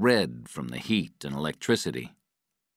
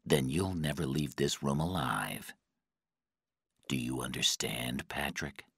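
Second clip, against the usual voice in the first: soft, lower-pitched menace; quiet threat; 3 semitones lower, 5 dB quieter than usual. A narrator's reading. Guy offers Patrick life or death, quiet threat. Interrogator threatens a tortured prisoner.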